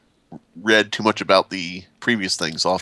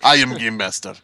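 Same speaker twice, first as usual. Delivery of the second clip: mock Russian declaration; bad Russian accent. Russian player claiming to run the game, bad Russian accent. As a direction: state it in a fake Russian accent. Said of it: "very bad attempt at a Russian accent".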